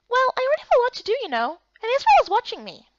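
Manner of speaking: high, quick nervous protest; hurried little objection; flustered girl character. Acted.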